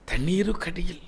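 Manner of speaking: hushed whisper for the depths; whispered, under the sea. Acted. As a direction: drop to a breathy whisper. Jonah under the water.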